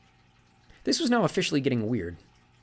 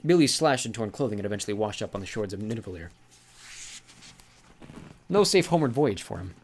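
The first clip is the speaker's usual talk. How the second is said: plain reading voice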